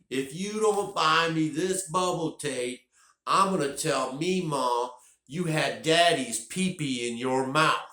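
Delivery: high, slow tantrum yell at the top of his lungs; yelling kid. Retold or imitated. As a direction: scream it out like a furious child. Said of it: "So he says at the top of his lungs".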